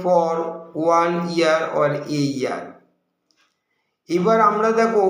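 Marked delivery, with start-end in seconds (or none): 0.0-2.6 slow demo sentence. Shown as a demonstration. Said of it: "We can say".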